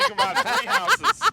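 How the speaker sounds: laughing through the line; high, loud, laughing sales pitch. Real, not acted. Laughing while pitching the deal.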